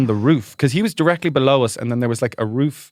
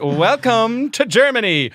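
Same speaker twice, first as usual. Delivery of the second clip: mock announcing; 11 semitones higher, 3 dB louder, slower than usual. Mother's confident wrong announcement.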